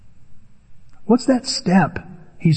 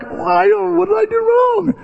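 high mock startled protest; high, surprised pleading. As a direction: act confused and wronged. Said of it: "I'm like".

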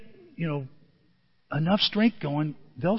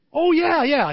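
sudden recognition; 9 semitones higher, 6 dB louder, slower than usual. Retold or imitated. Nursing home woman answering.

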